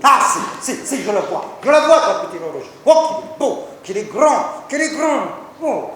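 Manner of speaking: gushing, high and loud over the dot; hammed-up rapture at a tiny thing; mock gushing. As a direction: overblown delight.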